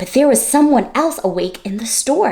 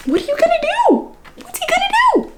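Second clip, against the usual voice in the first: much higher alarmed squeal; faster than usual. Acted. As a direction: squeal in mock panic for the bear. Storyteller alarmed for Corduroy.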